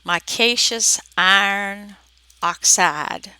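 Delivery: slow, words separated by pauses; measured, word-by-word enunciation; careful repetition. Shown as a demonstration. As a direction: say it slowly so it can be understood. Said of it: "nothing sounds intelligible, so".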